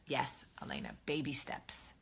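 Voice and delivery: whispered reassurance to a viewer. Real, not acted. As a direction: breathe it like a secret.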